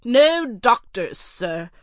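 a haughty butler reeling off a list of refusals; curt butler. Acted. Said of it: "continued the butler calmly".

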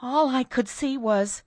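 high, hesitant, breaking off; horrified hesitation. A narrator's reading. Frightened maid to her mistress, hesitant with horror. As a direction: start fearfully and leave it hanging. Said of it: "Cordelia hesitated with an expression of the utmost horror".

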